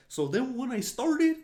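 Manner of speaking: high, swinging cartoonish voice; high impression with rising inflection; exaggerated character voice. Shown as a demonstration. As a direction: slip into the movie brother's high lilt. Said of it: "I gotta talk like that guy".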